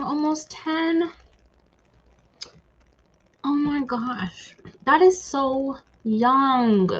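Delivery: slow, high, tearful sympathy; heartfelt sympathy. Real, not acted. A streamer sympathizing with a viewer, slow and tearful. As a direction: ache for her loss, words drawn out slowly. Sympathizing over a friend's dog.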